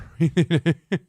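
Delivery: loud laugh. Real, not acted. Laughing at protest photo.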